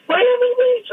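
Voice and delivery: very high, spaced-out voice; dazed tripper. Retold or imitated. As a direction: answer from far away, lost. Voicing the tripping patient.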